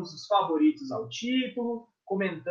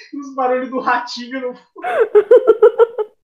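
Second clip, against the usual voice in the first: louder, laughing through the words; 5 semitones higher, 11 dB louder than usual. Real, not acted. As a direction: giggle it out. Laughing about the memes.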